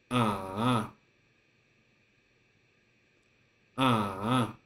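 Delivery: "Ah" dipping down then climbing back up; third tone demo. Shown as a demonstration. Teacher showing the roller-coaster tone, dipping "Ah". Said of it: "First, go down ... climb up".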